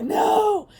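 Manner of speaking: high, loud mock protest; hammed-up horrified friend refusing; emphatic friend. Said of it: "she's just like".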